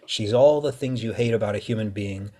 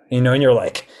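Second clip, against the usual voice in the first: usual speaking voice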